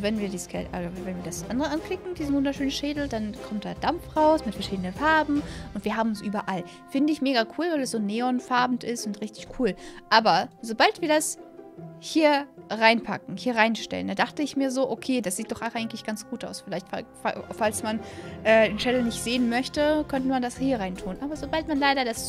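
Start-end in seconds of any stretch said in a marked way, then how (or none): none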